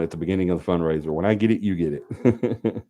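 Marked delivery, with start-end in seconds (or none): 1.1-2.8 slow, laughing catchphrase, lightly voiced; Michelle's catchphrase. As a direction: drawl it out through a laugh. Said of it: "That's what Michelle said".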